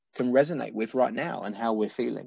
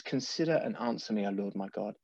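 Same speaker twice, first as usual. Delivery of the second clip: softer, hushed reading of the verse; quieter reverent reading; 5 semitones lower, 9 dB quieter than usual. Real, not acted. Preacher rereads the psalm verse to the congregation, hushed. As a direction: let the prayer drop to a hush. He says.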